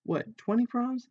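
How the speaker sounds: high, drawn-out disbelief; astonished. A narrator's reading. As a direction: ask it slowly, pitch leaping up in surprise. Startled reaction to twenty proms.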